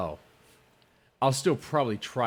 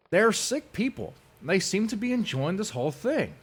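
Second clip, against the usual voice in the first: mocking imitation; 10 semitones higher than usual. Mocking the rivals' talk about him, high sneer. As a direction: parody their outrage.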